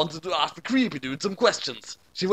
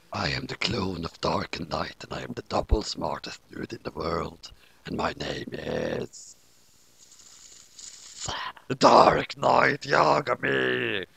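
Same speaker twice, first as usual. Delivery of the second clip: much lower, slow and soft, a grand creature; deep clone voice; 9 semitones lower, 3 dB quieter than usual. A narrator's reading. The lion-clawed clone introducing himself, deep and slow. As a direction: intone the name like a newborn monster.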